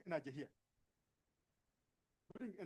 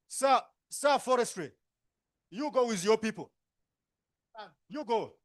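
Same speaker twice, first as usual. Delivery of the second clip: calling out; 3 semitones higher, 9 dB louder than usual. Real, not acted. Facilitator sending the forestry man off, loud. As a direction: call him over the room.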